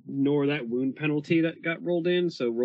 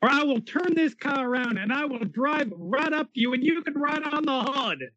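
high, exasperated parent threat; refereeing kids in car; 10 semitones higher than usual. Acted. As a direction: scold them like a fed-up parent.

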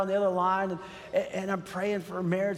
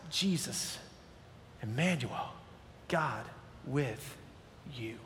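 hushed solemn naming; 8 semitones lower, 10 dB quieter, slower than usual. Real, not acted. Preacher naming Jesus to the congregation, hushed and solemn. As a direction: lower and softer, each word set apart. Sermon climax, word by word.

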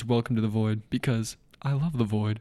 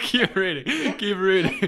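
laughing; 6 semitones higher, slower than usual. Real, not acted. Laughing at the sniper story.

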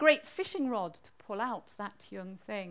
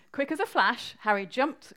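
plain reading voice

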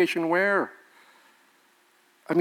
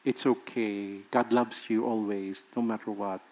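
soft, hushed, syrupy pastor voice; a mock soothing pastor cooing reassurance; sugarcoating pastor; 8 semitones lower, 9 dB quieter than usual. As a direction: coo it like a pastor soothing a crying woman. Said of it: "what does the pastor say?".

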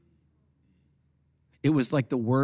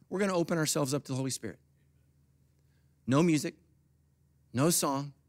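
ordinary talk

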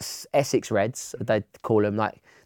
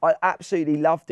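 laughing excitedly; 7 semitones higher, 3 dB louder than usual. Real, not acted. Laughing, excited about United.

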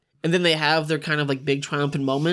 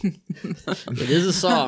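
laughing; much slower than usual. Real, not acted. Joking about the song title.

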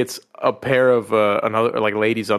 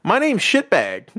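high, slow innocent voice; high innocent dog; 9 semitones higher than usual. Retold or imitated. Dog innocently tells the strays his name, high and slow. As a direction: say it up high and slowly like it's normal. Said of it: "He goes".

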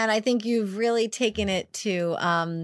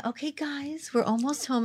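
eerily calm mom voice; 6 semitones higher than usual. Retold or imitated. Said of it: "Mom, that scary voice".

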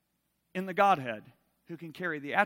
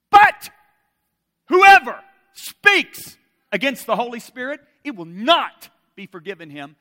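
explosive, loud scripture warning; booming pulpit proclamation, pitched high; thundering preaching; 7 semitones higher, 21 dB louder, slower than usual. Real, not acted. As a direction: hammer out the warning, pausing after the first words. Preaches scripture warning at full force.

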